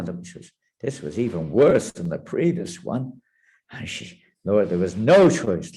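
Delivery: usual speaking voice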